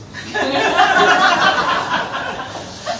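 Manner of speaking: high, long burst of laughter; real laugh. Real, not acted. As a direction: crack up at the line you just read. Laughs after reading a line.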